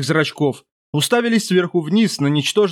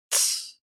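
soft unvoiced hush; shushing; 11 dB quieter than usual. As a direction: hush him gently. Witch hushing the officer.

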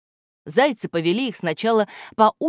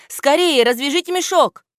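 louder, higher urgent cry; urgent exclaim; 9 semitones higher, 6 dB louder than usual. A narrator's reading. Gramoteyka urges the children to untie the sack: higher urgent cry. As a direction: urge them to hurry. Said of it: "воскликнул Грамотейка".

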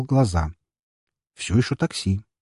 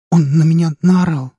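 high, loud tearful outburst; almost crying; 9 semitones higher, 8 dB louder than usual. A narrator's reading. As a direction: force it out close to tears. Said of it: "Чуть ли не плача, выдавила".

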